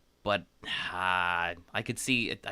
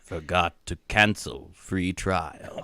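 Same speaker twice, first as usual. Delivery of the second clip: ominous; 4 semitones lower, 5 dB louder, much slower than usual. Pretend. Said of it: "maybe you should say more ominous".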